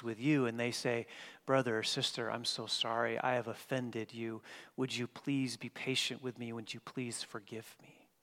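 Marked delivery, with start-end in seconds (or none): 1.5-8.1 soft apology. Retold or imitated. Preacher voicing an offender's apology.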